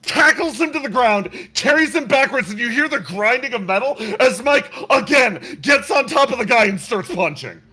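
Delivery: excited narration. Acted. Game master narrating the brawl, loud and breathless. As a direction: narrate the brawl like it's spiraling out of control.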